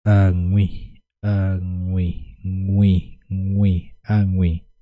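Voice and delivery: ñui clipped to the nasal ñ plus wi; emphatic pronunciation drill. Shown as a demonstration. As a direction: hammer in añui so the class can copy it. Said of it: "Se pronuncia de esta manera".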